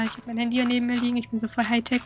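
ordinary talk, nothing marked

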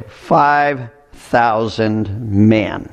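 five thousand men drawn out very slowly, word by word; very slow emphasis. Acted. Stressing the size of crowd.

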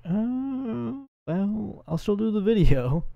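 squeaky high sing-song self-mockery; high put-on voice. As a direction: pitch way up like a whiny inner voice. Imitating his own reluctant thought.

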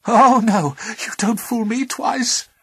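loud tearful protest; sobbing. Acted. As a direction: sob it out defiantly. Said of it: "sobbed Pandora".

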